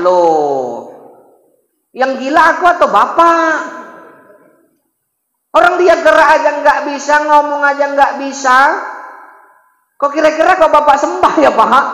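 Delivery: high, loud turning the tables; triumphant retort. Acted. Ibrahim turns the king's words back, triumphant retort. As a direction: catch the king in his own words.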